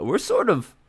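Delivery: ordinary talk, nothing marked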